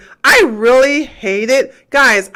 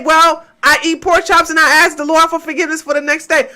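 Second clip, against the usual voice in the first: mocking quote; 7 semitones higher, faster than usual. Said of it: "and say".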